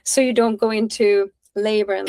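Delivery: usual speaking voice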